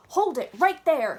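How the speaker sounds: lightly voiced janitor shouting; hollering. A narrator's reading. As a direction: bellow it after the running girl. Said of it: "he hollered again".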